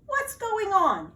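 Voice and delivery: loud, high, slow alarmed question; startled question. Acted. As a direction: demand to know what the fuss is. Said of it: "asked Badger".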